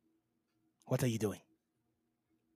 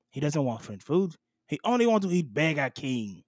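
ordinary delivery

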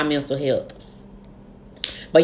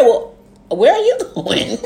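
slow, laughing, higher where are you going; shocked laughing question; 6 semitones higher, slower than usual. Retold or imitated. Asking friend where she's going, laughing disbelief. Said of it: "I said".

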